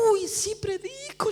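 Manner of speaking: slow, high, mock-frightened admission; startled concession. Preacher conceding to God after the vision, startled concession. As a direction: give in, acting shaken. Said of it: "yo quedo asustada".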